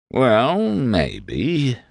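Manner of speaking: drawn-out, swooping self-satisfaction; smug. A narrator's reading. Shad half-agreeing to wait, very pleased with himself. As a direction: concede it slowly and smugly. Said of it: "with the smugness of a carp".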